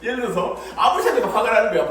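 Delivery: usual speaking voice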